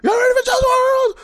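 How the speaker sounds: high, loud hype-man yell; imitating the concert hype man, shouting high. Imitating the hype man hyping the crowd for [name], loud shout. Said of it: "And then he's like".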